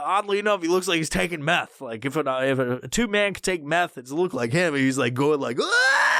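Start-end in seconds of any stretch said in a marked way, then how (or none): 5.6-6.1 very high mock wail; high-pitched goofy outburst; wacky scientist voice. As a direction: squeal like a wacky inflatable tube man. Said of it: "he's doing this fucking voice".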